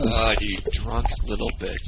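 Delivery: ordinary delivery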